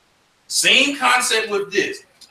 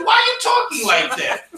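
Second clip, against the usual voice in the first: put-on much higher, teasing kid voice; mocking kid. Harlem kids tease a newcomer from Nashville, high mocking voice. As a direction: squeak it out like a kid making fun of an accent. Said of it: "the kids in Harlem gonna say".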